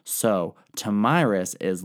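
ordinary talk, nothing marked

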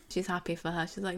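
usual speaking voice

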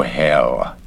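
plain reading voice

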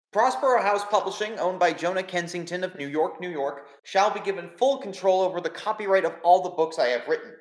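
formal, measured reading of a will; solemn will reading. Acted. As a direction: read it out like a lawyer, deliberate. Game master reads the will.

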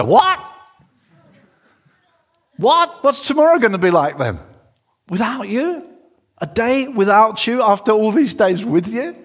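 high, loud, disbelieving questions; aghast, voice jumping up; acted panic. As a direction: play a disciple panicking at being left. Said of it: "I wanted you to kind of feel the panic".

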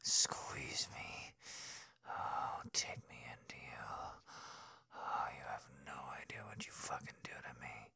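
breathy, close whisper into the ear; hushed, heated bedroom whisper; intimate whisper. Acted. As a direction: whisper it like a lover pressed close.

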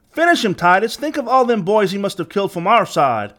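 put-on high-pitched young henchman; goading boy's voice, high and insistent; egging him on. A narrator's reading. Boy urges leader to kill.

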